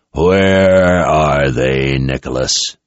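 very slow, low, menacing; dangerous question. Acted. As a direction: drag the question out as a threat. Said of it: "as dangerous sounding a question".

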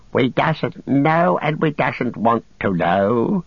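cower and whimper; Gollum whimpering. Acted. Frightened captive whimpering under the captor's gaze. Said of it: "he whimpered".

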